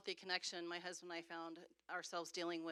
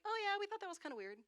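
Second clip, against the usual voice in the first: breezy shrug off; 13 semitones higher, faster than usual. Retold or imitated. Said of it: "they're like".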